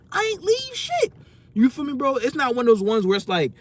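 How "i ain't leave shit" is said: high indignant. Real, not acted. Indignant about being called a quitter.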